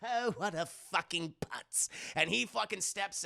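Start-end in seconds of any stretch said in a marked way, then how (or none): 0.0-1.9 sly villain voice full of scorn; hammed-up mocking clown; Joker. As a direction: gloat over a fooled victim. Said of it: "But Joker is like".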